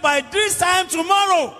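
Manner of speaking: high, emphatic prophetic proclamation; Elisha, confident declaration. Retold or imitated. Preacher quoting the prophet's promise of plenty, confident declaration. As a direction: declare it boldly and full of faith. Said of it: "with confidence and faith in God".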